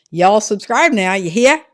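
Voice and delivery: loud, drawled Okie twang on "subscribe" and "hear"; showing a viewer how the Okie accent should sound. Shown as a demonstration. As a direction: belt it out with a thick Okie accent. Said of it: "put a little Okie twang on there".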